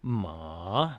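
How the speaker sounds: MA dipping low, then rising; slow scooped MA, down and back up; third tone dip. Shown as a demonstration. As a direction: dip MA like a valley. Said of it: "the dipper tone, as it were".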